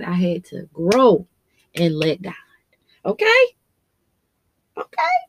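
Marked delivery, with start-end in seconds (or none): 3.0-5.2 high-pitched. Real, not acted. High emotional call to listener.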